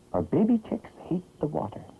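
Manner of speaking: ordinary delivery, nothing marked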